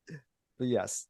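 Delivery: normal talking voice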